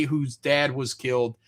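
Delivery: ordinary talk, nothing marked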